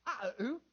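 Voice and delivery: "Ah, ooh" as vowels only for "I love you"; excited child's mispronunciation. Retold or imitated. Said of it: "I'd get all excited".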